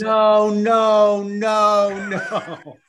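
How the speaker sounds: high, drawn-out wail of no; hammed-up cry of refusal; mock screaming. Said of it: "I'm screaming no".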